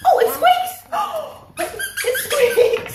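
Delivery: very high, loud squeal of delight; delighted surprise. Real, not acted. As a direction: shriek with glee at the toy. Toy squeaks, she reacts.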